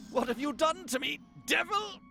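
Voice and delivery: outraged accusation. Acted. Accuses the witch of bewitching.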